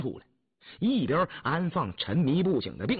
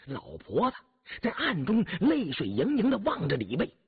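plain reading voice

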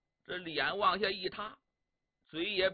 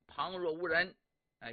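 ordinary delivery

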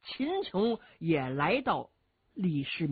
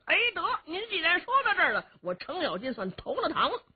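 high, giggly voice of a sly rough warrior; higher comic rogue voice with nervous hehe laughs; Cheng Yaojin's voice; 10 semitones higher, faster than usual. Acted. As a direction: play the cunning bumpkin, chuckling and stammering.

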